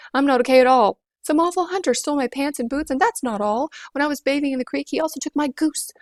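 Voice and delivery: put-on a boy near tears complaining; upset boy.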